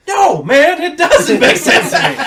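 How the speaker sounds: high, loud frustrated yell, slowing at the end; exasperated shout. Retold or imitated. Student shouts at the young genius teacher.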